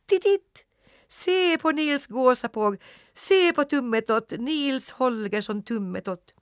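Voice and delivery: very high, loud twittering bird voices; chirping sparrows. A narrator's reading. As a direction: peep it like mocking sparrows. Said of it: "började pipa".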